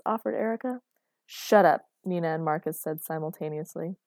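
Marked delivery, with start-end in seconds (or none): 1.2-1.9 loud, abrupt shut-up; exasperated burst; irritated outburst. Acted. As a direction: cut them off sharply and loudly. Both parents snap at interrupter.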